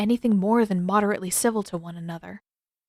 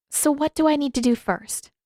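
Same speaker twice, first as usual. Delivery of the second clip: put-on higher girl's voice, insistent; stubborn young waterbender pleading her case; higher eager insistent voice; Katara, frustrated; 6 semitones higher than usual.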